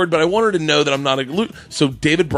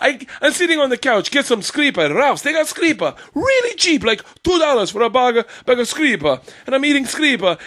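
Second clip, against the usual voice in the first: high, excitable Italian husband impression; hammed-up Italian-accented storyteller; 11 semitones higher than usual. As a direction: do a comic Italian accent, pitched well up. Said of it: "Her husband's an Italian guy".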